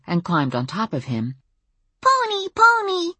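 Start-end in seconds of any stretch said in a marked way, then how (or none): 2.0-3.1 put-on very high, drawn-out child's squeal; gleeful preschooler voice; delighted little girl. A narrator's reading. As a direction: squeal at a pony as a tiny kid. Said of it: "said a high-pitched voice".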